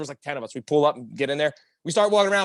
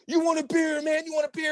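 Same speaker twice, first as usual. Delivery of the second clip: high-pitched, eager offer; fawning partygoers impression; 11 semitones higher than usual. Strangers offer popular friend beer, high fawning call. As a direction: call it out high like an adoring fan.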